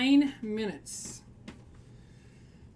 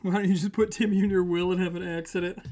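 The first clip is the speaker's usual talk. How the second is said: ordinary talk, nothing marked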